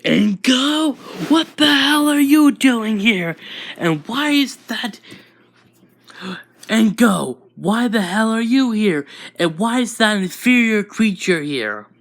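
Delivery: high, loud outraged bellow; giant yell. Acted. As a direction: yell across the crowd at an unwelcome daughter. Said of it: "they hear a giant yell saying".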